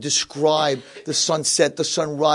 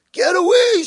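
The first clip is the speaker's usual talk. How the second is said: high, loud cranky shriek; enraged old man yell; screaming; 6 dB louder, slower than usual. Acted. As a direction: shriek at him to go. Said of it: "screaming at him".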